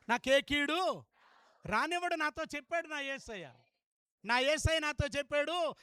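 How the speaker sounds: normal talking voice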